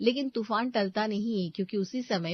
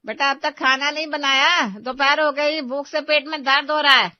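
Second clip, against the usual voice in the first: complaining mother-in-law; 7 semitones higher, 11 dB louder than usual. Acted.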